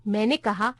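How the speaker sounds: plain reading voice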